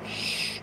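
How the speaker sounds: a soft unvoiced shush. Shown as a demonstration. Showing how the prisoners kept quiet, a soft shush. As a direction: hush them with a quick shh. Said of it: "shushing to show quiet".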